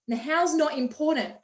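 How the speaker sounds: ordinary talk, nothing marked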